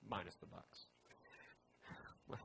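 slow, breathy whispered jab; teasing stage whisper; whispered aside. Real, not acted. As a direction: whisper the joke like a secret dig. Joking aside about rival team.

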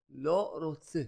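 ordinary delivery

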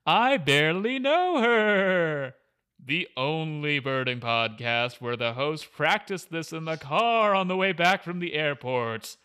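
loud, drawn-out ghostly wail of a welcome; haunt the intro with long, swooping words; hammed-up Halloween host as a ghost; spooky ghost voice. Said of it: "ghost voice".

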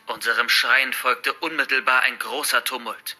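usual speaking voice

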